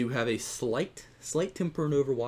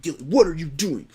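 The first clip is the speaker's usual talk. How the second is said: loud, higher-pitched mock scolding; raised-voice, exasperated retelling; exasperated teammate imitation; 4 semitones higher, 7 dB louder than usual. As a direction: imitate a teammate blaming someone. Blaming teammate imitated in a quarrel.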